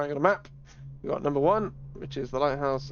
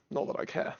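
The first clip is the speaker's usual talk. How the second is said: whispered aside; 6 dB quieter than usual. Real, not acted. Dismissive aside.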